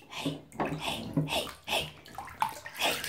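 playful whispered hey hey; soft unvoiced teasing whispers. Real, not acted.

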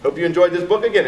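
ordinary delivery

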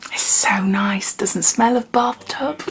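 soft breathy whisper of delight. Real, not acted. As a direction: whisper it, savouring. Whispered tasting reaction.

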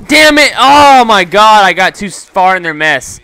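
high, loud yell of real rage; yelled in real frustration; furious shout. Real, not acted. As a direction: scream it at the screen. Dies in game, rages.

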